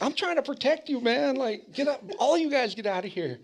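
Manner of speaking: high, fed-up protector voice; exasperated scolding. Retold or imitated. Dad telling the teens to clear off, exasperated scold. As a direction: shoo the kids away. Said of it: "and I'm like".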